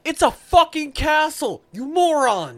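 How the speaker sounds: high, loud, slow exasperated yell. Real, not acted. Yelling at the reviewers, loud and exasperated. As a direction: shout it at the reviewers in disbelief.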